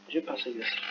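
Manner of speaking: usual speaking voice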